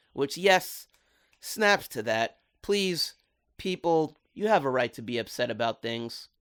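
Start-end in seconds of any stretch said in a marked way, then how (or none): none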